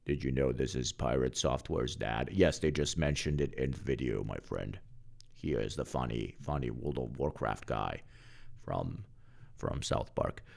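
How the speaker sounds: low-volume chatter; quiet aside. Real, not acted. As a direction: drop the volume and tell chat on the side. Aside to chat, muttered.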